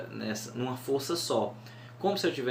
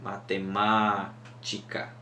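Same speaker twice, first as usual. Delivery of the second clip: slow, "Matemática" leaned on the first MA; "Matemática" said slowly with the weight on ma; stress on MA; 3 semitones lower, much slower than usual. Shown as a demonstration. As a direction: drag out the opening ma. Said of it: "ela vai terminar no ma".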